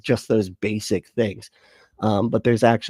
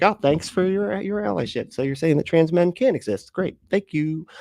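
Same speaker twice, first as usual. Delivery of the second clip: high, mocking fake gratitude; sarcastic thanks; 7 semitones higher than usual. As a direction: thank them with dripping irony. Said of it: "They're like".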